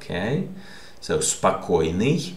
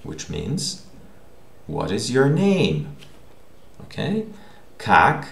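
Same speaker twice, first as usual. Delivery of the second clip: ordinary talk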